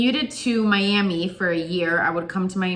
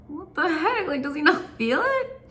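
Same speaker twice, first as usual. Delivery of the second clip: baffled; 8 semitones higher than usual. Retold or imitated. Confused at brother not reacting.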